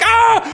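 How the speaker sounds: short high-pitched "ah" scream; high comic shriek; mock panicked yell. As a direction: imitate a group yelling in panic. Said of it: "but we were yelling too".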